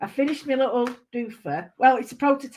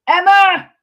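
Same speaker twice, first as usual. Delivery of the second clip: calling out; 9 semitones higher, 13 dB louder than usual. Real, not acted. Calling out to viewer.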